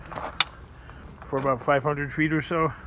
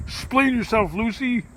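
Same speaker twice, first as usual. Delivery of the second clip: Ricky Ricardo impression; 7 semitones higher than usual. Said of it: "Ricky would be like".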